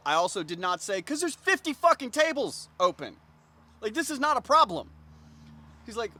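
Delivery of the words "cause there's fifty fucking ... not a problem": loud, high indignant rant; incredulous, raised-voice complaint; exasperated outburst. Retold or imitated. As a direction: let the unspoken irritation burst out. Imagined outburst he did not say.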